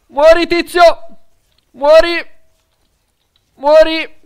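loud, high taunting shout of muori; battle cry. Real, not acted. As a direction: yell at the enemy to die. Shooting at enemy player.

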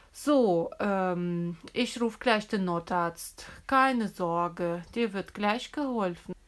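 put-on teacher calming the hurt child before calling help.

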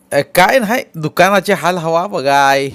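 चढ्या आवाजात, मोठ्याने बोलणारा गावकरी. Acted. (मोठ्या, चढ्या आवाजातला गावकरी)

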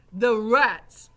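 very loud, emphatic repeat; Gregory. A narrator's reading. Gregory repeating for Mig, loud voice. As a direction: bark it for someone deaf.

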